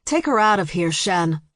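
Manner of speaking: plain reading voice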